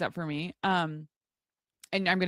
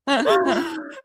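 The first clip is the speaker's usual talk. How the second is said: loud high laugh; burst of laughter; 8 semitones higher, 7 dB louder than usual. Real, not acted. Laughing at the sister's haircut story, real laugh. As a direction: crack up at the joke.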